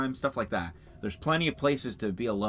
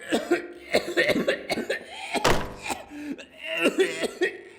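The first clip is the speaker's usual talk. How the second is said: coughing laugh. Real, not acted. Coughing after a bong joke.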